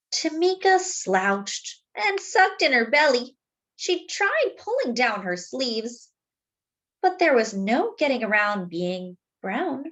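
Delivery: put-on gentle read-aloud for children; story narration. A narrator's reading. Narrator reading a story to young listeners, gently. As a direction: tell it like a bedtime picture book. Reads the picture book aloud.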